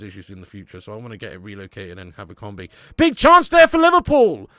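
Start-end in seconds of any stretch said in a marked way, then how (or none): 3.0-4.5 loud, high live goal-chance call; excited match shout. Real, not acted. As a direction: shout out the chance as it happens, voice shooting up.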